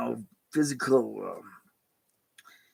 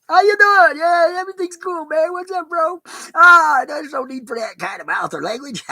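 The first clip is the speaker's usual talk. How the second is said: high, loud breezy reply; mock cheerful calm; 8 dB louder than usual. Passenger rehearsing a cool reply to a cusser, high breezy voice. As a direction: play it casual and unbothered.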